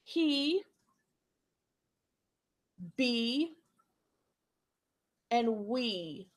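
spaced-out words for repeating; teacher modelling words one at a time; echo words. Shown as a demonstration. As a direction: say each word alone, leaving room for an echo. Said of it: "echo these words back to me".

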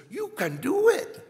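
imitated French accent, pitched high and drawn out; slow, high-pitched French-accented encouragement. Chief apostle reminding us we can, in a French accent. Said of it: "he says it with this kind of French accent".

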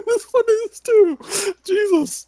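high, laughing whine; laugh-sob through it; laughing cry. Real, not acted. Laughing at sad card.

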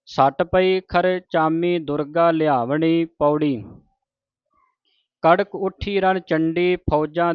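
measured, chanted reading of sacred verse; scripture recitation. Shown as a demonstration. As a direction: recite each line deliberately, with pauses.

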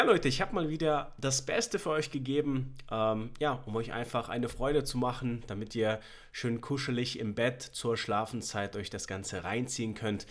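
plain reading voice

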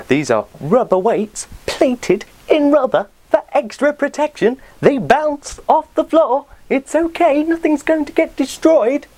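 exasperated protest. Retold or imitated. Retelling his reply to the gym guy, exasperated high protest.

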